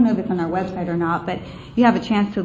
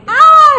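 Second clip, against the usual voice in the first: a huge, high victory yell; retold yell; 13 dB louder than usual. Reliving the celebration after the vote, a huge high victory yell. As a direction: yell "Ah" like the crowd did. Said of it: "everyone's yelling again".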